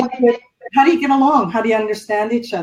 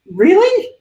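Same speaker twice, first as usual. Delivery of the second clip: mock disbelief; 10 semitones higher than usual. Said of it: "looking at myself going".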